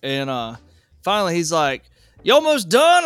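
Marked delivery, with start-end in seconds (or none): 2.2-3.0 high, loud imitated holler; calling out. Husband calling down to the technician, loud high holler. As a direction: imitate a man hollering into a crawl space. Husband calls into the crawl space.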